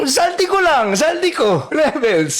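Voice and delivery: ordinary talk, nothing marked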